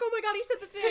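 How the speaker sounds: high, loud gleeful squeal; excited fan reaction. Retold or imitated. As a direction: retell it with loud, high-pitched delight. Retelling own delighted fan reaction.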